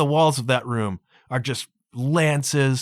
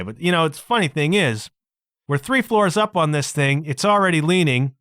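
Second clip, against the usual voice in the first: usual speaking voice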